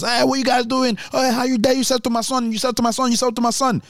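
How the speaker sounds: high, scolding mum, broken English; angry immigrant mum. Retold or imitated. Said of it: "her English wasn't even great".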